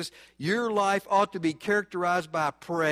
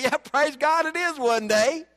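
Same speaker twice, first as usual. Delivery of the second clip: joyful reply; 7 semitones higher, 4 dB louder than usual. Retold or imitated.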